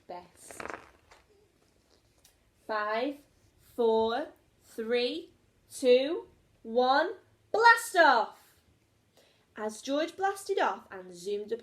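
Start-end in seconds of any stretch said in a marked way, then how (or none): 2.7-8.4 from firm to a big loud blast off; building countdown. A narrator's reading. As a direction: count down louder and louder, slow the final call. Rocket launch countdown.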